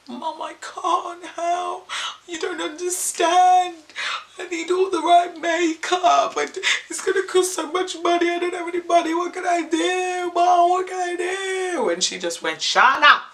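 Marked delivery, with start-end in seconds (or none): 0.1-11.9 very high, drawn-out whine; hammed-up tearful wail at a much higher pitch; mock wailing. Daughter protests to mum that she can't afford makeup, mock wailing. 12.6-13.2 loud, high Jamaican mum; Jamaican accent. Retold or imitated. Mum cuts off her panicking daughter, loud Jamaican accent. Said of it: "She's Jamaican".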